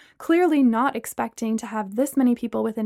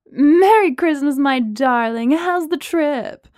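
loud, slow motherly greeting; booming; 4 semitones higher, 6 dB louder, slower than usual. A narrator's reading. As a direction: boom it out like she's on a speaker. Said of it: "Veronica's voice boomed over the speakers".

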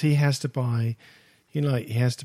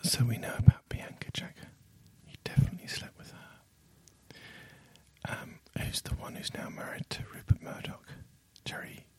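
hushed breathy whisper; ASMR whisper; 2 semitones lower than usual. Pretend. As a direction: whisper close to the mic. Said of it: "You've gone to the A S M R voice".

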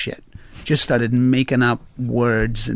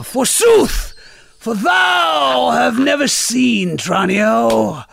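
hammy stage actor; 8 semitones higher, much slower than usual. Retold or imitated. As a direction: declaim gibberish like a ham actor. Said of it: "thirty seconds of me just".

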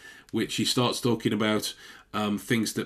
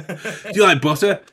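mock question laughing; 8 semitones higher, 7 dB louder than usual.

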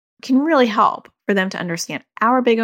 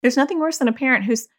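ordinary talk, nothing marked

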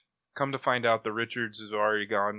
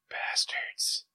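voiceless aggrieved whisper; whispered curse; 4 dB quieter than usual. Retold or imitated. Reader cursing publishers over a one-shot, whispered curse. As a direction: whisper it as a curse under the breath.

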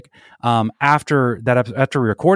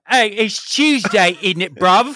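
mock London British accent, higher and drawn out; imitating Idris Elba's British accent, raised pitch, slow; 8 semitones higher, slower than usual. Co-host mocks Idris Elba's real London accent. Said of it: "making fun of British people".

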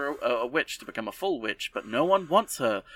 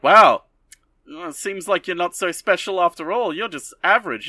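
louder, higher, sneering; condescending mock; 6 semitones higher, 8 dB louder than usual. Teacher taunting the defeated apprentice, loud and smug. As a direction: belittle her with smug scorn.

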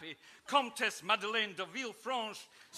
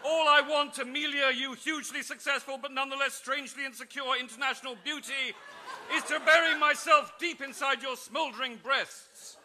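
high, loud hammed-up ardour; passionate lord; 6 semitones higher, 5 dB louder than usual. Lord Guy declaring to Amelia, hammed-up passion. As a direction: overblown romantic plea, laughing through it.

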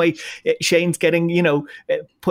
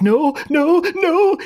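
put-on high, louder, frightened "no" in a Scottish accent; imitating panic; 11 semitones higher, 3 dB louder, much slower than usual. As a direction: cry out in mock fear. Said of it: "she was like".

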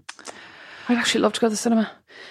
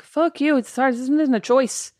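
higher-pitched imagined outburst; mock indignant retort; 6 semitones higher than usual. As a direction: snap back in pretend indignation. Imagined retort while crying.

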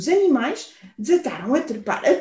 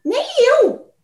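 very high mouse squeak; shrill squeak; 11 semitones higher, 3 dB louder than usual. Acted. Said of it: "chiou o rato estridente".

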